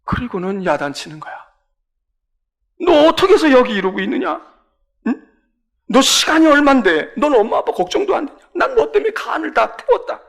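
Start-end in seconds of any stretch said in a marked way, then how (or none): 2.8-10.2 higher, louder angry scolding; scolding parent. Acted. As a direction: scold the runaway child. Parents scolding found son.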